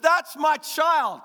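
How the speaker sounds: beam like a proud father; proud God. Pretend. God boasting over his child like a proud father. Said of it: "He looks at us and said".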